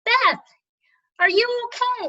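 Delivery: high, loud call across the water; worried hail from a motorboat; yelling from a boat. Retold or imitated. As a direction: shout it out to someone on shore. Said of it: "he yells out".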